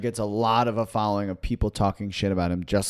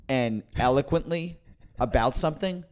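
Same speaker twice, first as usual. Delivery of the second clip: ordinary talk, nothing marked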